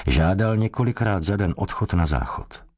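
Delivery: ordinary delivery, nothing marked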